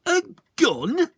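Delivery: high, loud scornful outburst; offended poacher; disgusted cry. A narrator's reading. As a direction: spit it out in disgust. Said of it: "he cried, disgusted".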